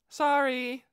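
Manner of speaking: put-on high, loud little girl sorry; cheeky girl apology. Aura apologizing to the startled girl, high and cheeky. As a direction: toss off a playful sorry.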